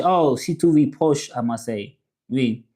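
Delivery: normal talking voice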